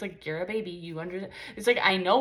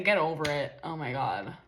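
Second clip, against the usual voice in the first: mean dismissal; 6 semitones lower than usual. Retold or imitated. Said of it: "in a mean way".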